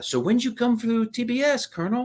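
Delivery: high put-on voice of the bartender; imitating the bar lady. Bartender asking the old colonel about his class, high imitation. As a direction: lift the voice way up to play her. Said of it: "and she goes".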